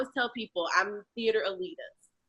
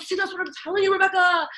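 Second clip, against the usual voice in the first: higher, delighted told-you-so; a higher gleeful director proving his point; imitating the laughing director; 10 semitones higher, 5 dB louder, faster than usual. Director telling Rebecca she is funny, higher gleeful. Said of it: "He's like".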